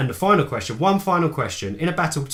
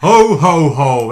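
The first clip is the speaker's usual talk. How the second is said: loud booming Santa laugh; mock Santa ho ho ho; 10 dB louder, much slower than usual. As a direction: boom it out like Father Christmas.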